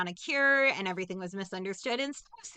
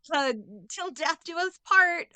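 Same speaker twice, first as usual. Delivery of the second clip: hammed-up horror, higher and louder; mock dramatic husband; 7 semitones higher, 3 dB louder, slower than usual. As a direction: play the husband in mock alarm, voice up high. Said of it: "he's like".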